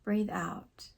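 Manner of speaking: soft, calm meditation guide with long pauses; hushed, soothing relaxation voice; guided meditation. Acted. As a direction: gently lead them through breathing and reflection, leaving space.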